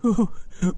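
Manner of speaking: short high laugh of glee; delighted high giggle. Acted. Scrooge laughing at the parrot, high giggle. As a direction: giggle like a child.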